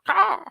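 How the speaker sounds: very high loud squawk; shrill cartoon caw; seagull caw. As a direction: end on a bird's caw. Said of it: "he'll be like".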